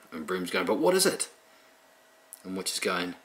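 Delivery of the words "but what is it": much higher, odd squeaky broom voice; a cranky little broomstick demanding answers; grumpy broomstick. Retold or imitated. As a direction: uptight broomstick quizzing the witch, high and cranky. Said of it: "her broomstick is a grumpy thing".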